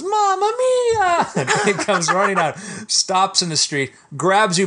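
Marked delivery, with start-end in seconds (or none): 0.0-1.3 high, slow, long "Mamma mia!"; Italian cry. Retold or imitated. Second Italian cop fleeing, high slow "Mamma mia!". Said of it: "The other one goes".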